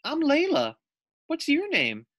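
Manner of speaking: put-on high, eager young girl; little girl voice. Layla greets the lizard in a high little girl voice. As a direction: ask brightly like a small child.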